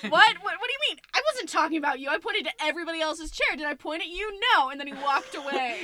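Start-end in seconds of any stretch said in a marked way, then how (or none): 0.0-4.7 loud, higher indignant ranting; mock defensive rant. [name] denying he complimented the lead, loud mock rant. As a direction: protest too much, hammed-up.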